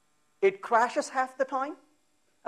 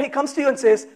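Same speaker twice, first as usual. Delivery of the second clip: ordinary talk, nothing marked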